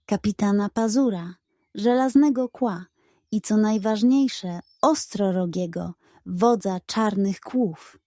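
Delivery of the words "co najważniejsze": ordinary delivery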